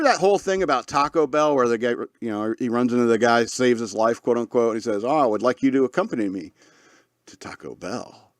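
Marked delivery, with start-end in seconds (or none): 5.0-8.3 a lightly voiced grand invitation with a stagey pause before the last words; dramatic pause. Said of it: "he did a dramatic, dramatic pause".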